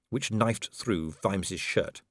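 plain reading voice

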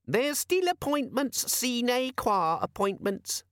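very high and slow, lecturing; pedantic squeaky demon. Acted. The demon lecturing Vimes on appointments, squeaky and slow. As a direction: spell it out like a fussy know-all.

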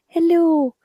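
high, slow Donegal lilt; Donegal accent. Retold or imitated. Solicitor from Donegal answering the phone, imitated Donegal accent. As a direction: put on a sing-song Donegal accent. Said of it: "he's from Donegal".

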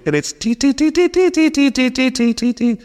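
light, high, lilting beeps; gentle alarm imitation. Preacher mimics the calm phone alarm, lightly chiming. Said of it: "it will wake me calmly".

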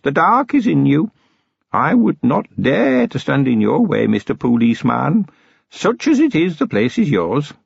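put-on an old goblin testing a human, calm and sly; old goblin chieftain. A narrator's reading. Old goblin challenging the policeman who wants to search, calm and sly.

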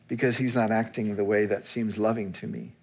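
normal talking voice

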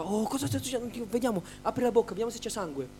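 padre spaventato. Retold or imitated. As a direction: play the alarmed father checking on his child. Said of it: "Mio padre si è spaventato tantissimo". (padre spaventato, imitato con voce più acuta)

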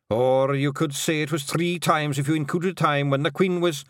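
a hint of a bard, cut off mid-word; William the Gonigal. A narrator's reading.

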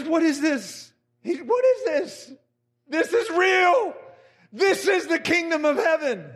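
retold tearful exclamation, pitched well up; imitated weeping awe. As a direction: cry it out as if overcome by God. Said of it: "He is weeping under the presence".

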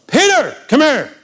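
high, loud summons; Jesus calling Peter across the water; commanding call. Retold or imitated. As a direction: call Peter over with authority. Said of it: "He doesn't say things like".

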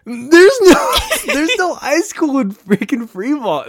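high laughing outrage; laughing disbelief. Real, not acted. Telling a friend a funny story, laughing.

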